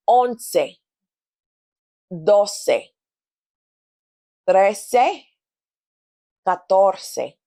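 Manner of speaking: each number spoken clearly with a pause after it; counting for repetition. Shown as a demonstration. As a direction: count slowly, leaving room to repeat. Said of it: "it goes like this".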